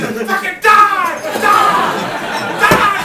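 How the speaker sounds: high, loud, slow furious yells; shouting death. Retold or imitated. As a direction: scream for blood at the screen. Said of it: "shouting death to this fucking man".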